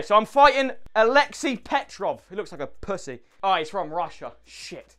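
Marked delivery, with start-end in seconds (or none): 4.4-5.0 whispered. Real, not acted. Fighting a Russian, scared.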